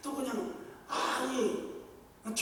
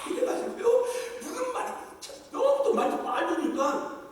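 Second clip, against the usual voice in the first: laughing; 11 semitones higher, 7 dB louder than usual. Real, not acted. Laughing about the pastor's accent.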